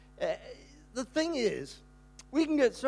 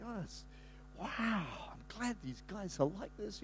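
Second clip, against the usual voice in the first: hushed aside; 12 dB quieter than usual. Retold or imitated. Quiet aside at noisy match.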